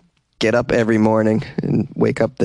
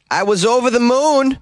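excited quote; 10 semitones higher than usual. Retold or imitated. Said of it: "She said".

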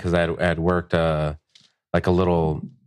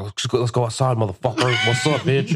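pitched-up challenge to a fight; mock-aggressive call-out; retold wilding out; 4 semitones higher than usual. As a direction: goad him, acting out the old bravado. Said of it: "just wilding out".

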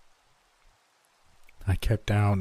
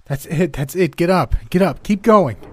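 urgent shouting; 9 semitones higher, 8 dB louder than usual. Acted. Narrator urges the exhausted girl on, shouting louder and higher. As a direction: shout building from loud to very loud and high. Urging fallen child to rise.

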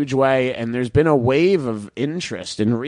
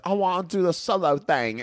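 bad put-on British accent, higher and softer; 5 semitones higher, 4 dB quieter than usual. Bandmate announcing he's leaving Queen, in a bad British accent. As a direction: do a hammed-up, failed British accent impression. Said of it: "that's not an amazing [name] accent".

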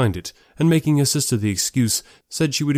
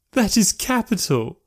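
high, slow, delighted drawl; amused sister; 11 semitones higher, slower than usual. Acted. Sister delighted at the Cheapside joke, amused. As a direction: relish it in a pitched-up lady's voice. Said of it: "they both laughed heartily".

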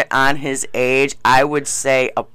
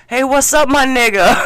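higher, louder hammed-up wannabe voice; mock wannabe greeting; 9 semitones higher, 4 dB louder than usual. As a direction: ham up the fake-tough greeting. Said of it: "and he goes".

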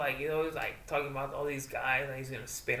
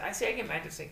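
ordinary talk, nothing marked

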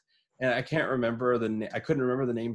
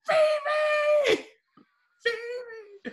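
very high, loud search call; 3 dB louder than usual. Retold or imitated. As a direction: call a lost dog across the block. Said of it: "walking around outside going".

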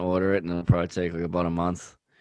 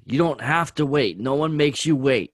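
loud; 6 semitones higher, 3 dB louder than usual. Real, not acted. Teasing argument.

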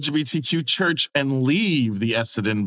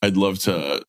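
much lower, husky come-on; pretend flirting; 8 semitones lower than usual. Host acting out a same-sex crush, in a low come-on voice. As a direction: pretend to purr a pickup line. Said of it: "So I could be like".

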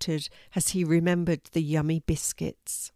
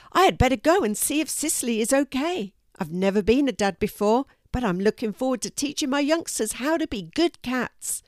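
fast, higher cat voice; hurried, high-pitched proud cat; excited cat character; 6 semitones higher, 4 dB louder than usual. A narrator's reading. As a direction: rattle it off eagerly.

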